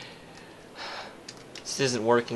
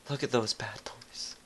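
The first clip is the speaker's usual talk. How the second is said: ordinary delivery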